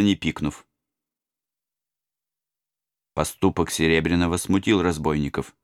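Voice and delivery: ordinary delivery, nothing marked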